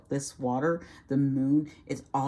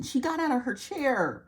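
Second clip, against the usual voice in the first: normal talking voice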